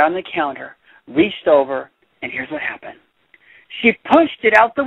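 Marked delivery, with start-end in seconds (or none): 2.2-3.0 suspense whisper. Acted. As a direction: whisper the setup, building tension. Suspenseful lead-in to climax.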